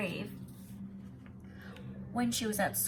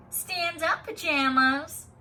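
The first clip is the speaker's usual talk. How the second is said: 1 taunting; 8 semitones higher, 9 dB louder, much slower than usual. Acted. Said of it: "The red-haired boy poked at my back".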